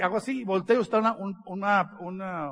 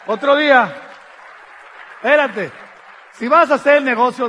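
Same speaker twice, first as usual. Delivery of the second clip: loud call out; 4 semitones higher, 11 dB louder than usual. Real, not acted. Speaker exhorts the audience.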